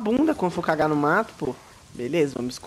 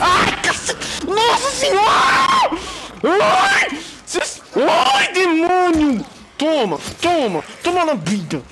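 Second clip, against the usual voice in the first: high panicked yelling, much louder; real fright scream; 14 semitones higher, 6 dB louder, much slower than usual. Real, not acted. Gamer jolted by a monster screams in real panic. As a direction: shriek in genuine terror at a jump scare. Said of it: "eu assustei do mesmo jeito".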